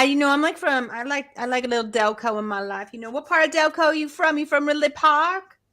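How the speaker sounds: put-on high-pitched Philly accent; Delco Philly accent. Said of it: "Delco, do it".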